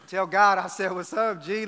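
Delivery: loud playful call-up to God; joking loud quote. Retold or imitated. Pastor jokes to tall volleyball player, loud and playful. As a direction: boom it out like a joke to the sky.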